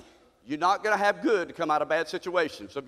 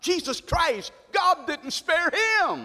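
higher, slow cry on God didn't spare him; high preaching climax; 4 semitones higher, slower than usual. Acted. Preacher stressing the sacrifice, slow and high. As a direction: lift it to a slow ringing cry.

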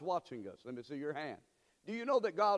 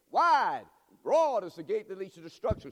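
loud burst of scripture; forceful preaching; 8 dB louder than usual. Real, not acted. Preacher warns the church of destruction, loud and forceful. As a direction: punch out the opening words loudly. Preacher proclaims warning verse.

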